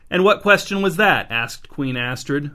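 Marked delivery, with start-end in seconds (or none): 0.1-1.3 high, louder feigned-innocent question; innocent query. A narrator's reading. Queen feigning innocence to her husband, higher and louder. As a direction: ask as if butter wouldn't melt. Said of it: "a query of pure innocence".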